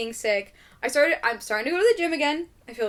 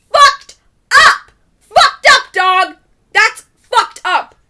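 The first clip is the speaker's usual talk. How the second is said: much higher and louder, slowing at the end; outraged yell; 10 semitones higher, 16 dB louder, much slower than usual. Real, not acted. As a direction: bellow it in mock outrage. Outraged at missing the movie.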